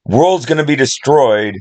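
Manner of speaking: lightly voiced movie line; Morgan Freeman quote. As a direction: toss off the quote with a grin. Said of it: "he says".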